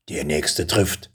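slow, low, menacing dragon warning. Acted. Dragon warns the boy slowly and low, menacing. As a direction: let the threat sink in, slowly and low. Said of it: "warnte der Drache".

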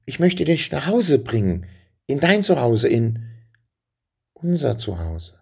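put-on high, urgent boy's cry; hasty call. Boy hastily cries out high to the dragon. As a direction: blurt it out fast to stop the fire. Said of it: "als Benjamin hastig rief".

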